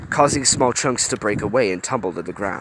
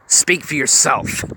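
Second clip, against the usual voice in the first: hissed; 5 semitones higher, slower than usual. A narrator's reading. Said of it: "Honey hissed".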